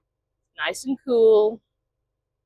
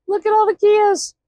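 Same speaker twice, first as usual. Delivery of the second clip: excited; 14 semitones higher, 7 dB louder than usual. Real, not acted. Excited exclamation at trucks.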